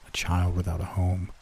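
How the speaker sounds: ordinary delivery, nothing marked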